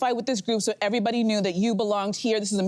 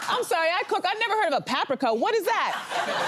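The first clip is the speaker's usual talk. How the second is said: higher-pitched mock confusion; acted puzzlement; 6 semitones higher than usual. Co-host re-enacting her confusion at hearing paprika, mock-puzzled. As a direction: play baffled, pitched up, with laughter near. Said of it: "And I was like".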